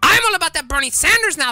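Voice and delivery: usual speaking voice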